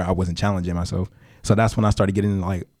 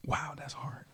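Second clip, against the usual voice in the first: whispered to himself; 7 semitones higher, 17 dB quieter, slower than usual. Real, not acted. Stumped by hard question.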